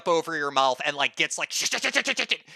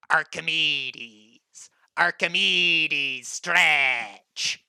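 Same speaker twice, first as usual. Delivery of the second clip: very slow lower introduction; big Kenku introducing himself slowly; slow drawn-out name; 4 semitones lower, much slower than usual. Acted. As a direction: stretch out the name.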